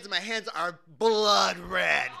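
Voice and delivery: normal talking voice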